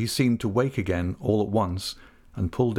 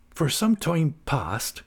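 slow, higher, hesitant rustic voice; mildly hesitant countryman, pitched up; faltering Ham; 6 semitones higher, slower than usual. A narrator's reading. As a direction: falter slightly, drawing the words out. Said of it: "Ham faltered".

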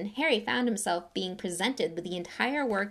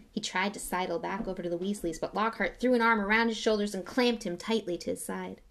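plain reading voice

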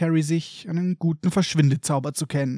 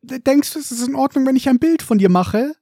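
put-on high, hopeful boyish voice; a small fan asking shyly for a photo; eager young Colin; 8 semitones higher than usual. Colin asks Harry for a photo, high and hopeful.

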